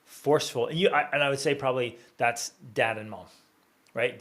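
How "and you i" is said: normal talking voice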